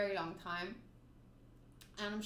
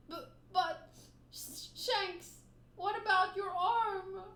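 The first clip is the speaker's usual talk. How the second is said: put-on child's voice; 9 semitones higher, 4 dB louder, slower than usual. Young Luffy tearfully asks Shanks.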